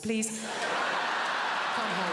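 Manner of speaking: mild Spanish mother pleading; Spanish mum voice. Retold or imitated. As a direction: pile on the food like a doting Spanish mum. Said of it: "conversation always goes like this".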